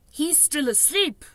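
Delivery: plain reading voice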